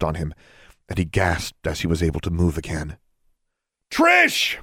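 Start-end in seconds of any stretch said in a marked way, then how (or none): 3.9-4.6 very high, full yell of a name. A narrator's reading. Heroine calls to girl fallen off the cliff, yelling. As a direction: yell far down into the distance. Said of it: "Alexandra yelled".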